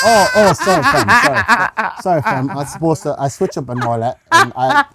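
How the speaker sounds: high, loud apology through laughter; mock-flustered, laughing sorry. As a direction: apologise while cracking up. Caught switching accents, apologises laughing.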